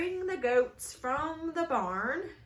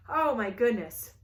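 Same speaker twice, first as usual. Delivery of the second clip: plain reading voice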